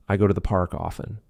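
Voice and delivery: OFTEN as "offen" at the sentence end; silent T. Shown as a demonstration. Teacher giving an example sentence, OFTEN with silent T. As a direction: run the sentence through with no T in OFTEN. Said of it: "I'm not saying often".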